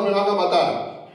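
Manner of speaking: normal talking voice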